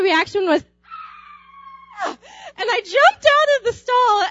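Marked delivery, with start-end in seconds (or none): 2.0-2.2 short startled scream; sudden frightened shriek; retold scream. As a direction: yelp like you just saw a spider. Sees a huge spider, screams.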